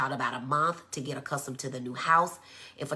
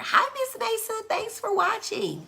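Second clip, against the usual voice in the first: gushing greeting. Real, not acted. Host welcoming a viewer to the live, gushing and high. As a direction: greet them in a high sing-out voice.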